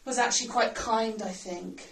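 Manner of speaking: ordinary delivery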